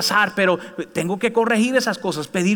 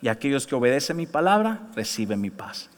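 1 gentle voice of God; 6 semitones lower, 5 dB quieter than usual. Retold or imitated. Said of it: "Dios me dijo".

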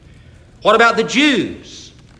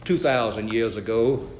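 put-on low, soft Southern accent; R G Lee; 10 semitones lower, 5 dB quieter than usual. Preacher answers the astonished guide, low Southern accent. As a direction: imitate a Southern preacher, pitched well down. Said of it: "had a real Southern accent".

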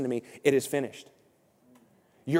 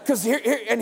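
high, indignant Southern rant; hammed-up Southern churchgoer laying down the law; cranky Southern complainer, pitched up; grumpy Southern guy; 7 semitones higher, 3 dB louder than usual. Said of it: "the grumpy Southern guy is my second voice".

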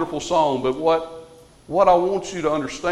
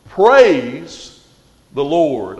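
loud rising Praise, then the Lord; exclaimed praise; 4 semitones lower, 5 dB louder, much slower than usual. Acted. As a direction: proclaim it with joy. Reading psalm ending, exclaimed.

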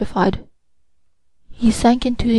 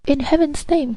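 unhappy cry; 6 semitones higher than usual. A narrator's reading. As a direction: cry out in mild distress, pitched up. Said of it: "cried the unhappy young man".

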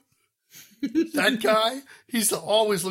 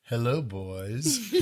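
low, very slow, softer come-on; sultry seminar host; 7 semitones lower, 7 dB quieter, much slower than usual. Retold or imitated. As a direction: purr it at the crowd. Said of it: "Karen's gonna be up on stage".

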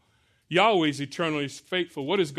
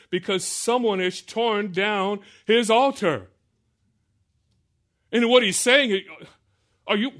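forceful preaching; 5 semitones higher, 4 dB louder than usual. Acted. Preacher reading Joash's challenge to the crowd, forceful and loud. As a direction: thunder the challenge at the idol-worshippers. Reads and preaches Baal passage.

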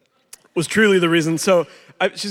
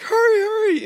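put-on very high little-boy plea; high frantic child voice; urgent child. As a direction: squeak it like an impatient kid. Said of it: "he's like".

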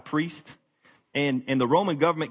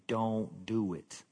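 slow, softer inner voice; gentle warning; 4 semitones lower, 7 dB quieter, much slower than usual. Acted. Holy Spirit warns the preacher against sin, slow and soft. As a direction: hold him back gently and slowly.

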